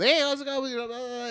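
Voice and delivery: high, eager hello; hyperactive greeting. Retold or imitated. Hyperactive salesman greeting a customer, high and eager. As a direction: bounce in with big energy. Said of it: "I'm very hyperactive".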